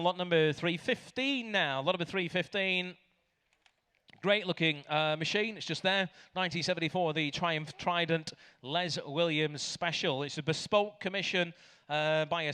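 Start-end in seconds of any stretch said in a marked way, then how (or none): none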